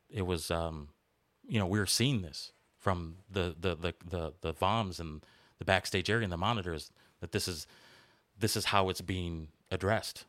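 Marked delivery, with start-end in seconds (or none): none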